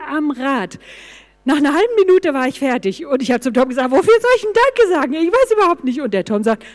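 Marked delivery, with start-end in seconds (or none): 3.9-6.0 higher, louder, quick protest; quick high-pitched grumble at being given a task; exasperated complaint. Retold or imitated. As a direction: retell it as a baffled, fed-up complaint. Retold exasperated complaint to Tom.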